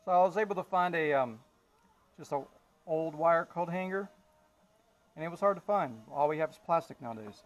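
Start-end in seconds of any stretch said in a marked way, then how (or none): none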